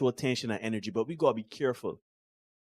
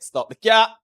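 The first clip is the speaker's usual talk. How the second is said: louder, pushier, higher call-out; 9 semitones higher, 9 dB louder than usual. Real, not acted. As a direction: really insist on it, teasing.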